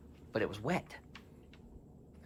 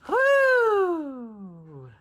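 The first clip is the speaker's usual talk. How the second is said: high loud whoop; happy whoop; 7 dB louder than usual. Acted. Fat happy worm cheering, high whoop. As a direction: whoop with full joy. Worm thrilled after eating.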